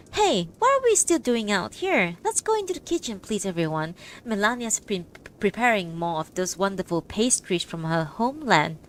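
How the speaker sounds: plain reading voice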